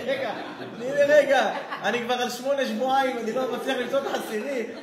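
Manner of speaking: loud, laughing mock plea to wait; hammed-up begging while laughing. Husband begs wife for a moment, loud laughing plea.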